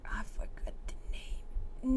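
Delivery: hushed frustrated whisper. Real, not acted. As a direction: breathe it out under your breath. Frustrated aside on forgetting a name.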